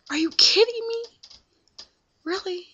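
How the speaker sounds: furious yell. Retold or imitated. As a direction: scream it at him in disbelief. That made me mad.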